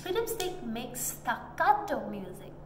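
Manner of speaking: usual speaking voice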